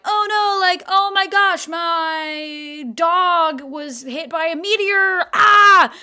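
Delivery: high, loud fake wail ending in a yelp; hammed-up disaster excuse, drawn out; mock panic. Girl making up an excuse to avoid meeting him, mock panic.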